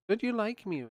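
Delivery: high, plaintive wife voice; imitating his wife. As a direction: whine it like a hurt wife. Voicing his wife pleading.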